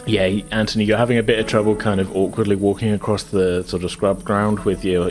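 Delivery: ordinary delivery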